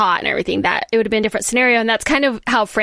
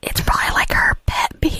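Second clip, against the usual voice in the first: hushed whisper. Real, not acted. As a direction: keep it to a whisper. Still hiding the dead bee.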